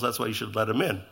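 ordinary talk

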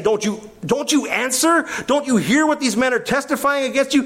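high-pitched, insistent cross-examining; badgering interrogation. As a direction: hound the witness with rapid-fire accusing questions. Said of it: "He's gonna badger Jesus".

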